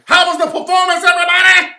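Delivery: high, very loud shouted hype-man voice; screaming crispy character voice; crispy voice yell. Retold or imitated. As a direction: bellow it like a hyped-up character who won't quit. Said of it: "staying in the crispy character".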